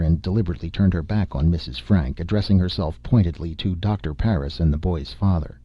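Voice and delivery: plain reading voice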